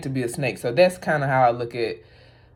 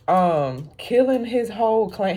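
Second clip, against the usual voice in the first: normal talking voice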